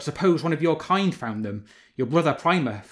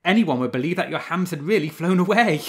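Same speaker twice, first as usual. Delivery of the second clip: mocking fox; 5 semitones higher, 6 dB louder than usual. A narrator's reading. Fox mocks mourning wolves.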